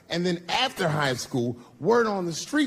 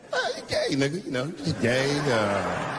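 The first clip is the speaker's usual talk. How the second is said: vague, slurred noncommittal mumble; mock mumbling. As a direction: trail through it as an awkward mumble. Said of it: "I started mumbling again".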